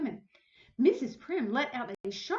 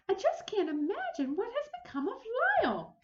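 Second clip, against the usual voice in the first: higher lady's voice, puzzled; puzzled exclaim; 12 semitones higher than usual. Acted. Missus Prim puzzled over missing Lyle, lightly raised lady's voice. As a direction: wonder aloud where he went. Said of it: "he heard her exclaim".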